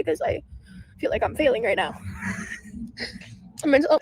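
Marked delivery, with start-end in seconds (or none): 2.0-3.2 breathy half sob, half laugh; quiet sob-laugh. Real, not acted. Streamer overwhelmed by steps breaks into a teary laugh. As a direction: let a teary laugh escape. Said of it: "So dramatic".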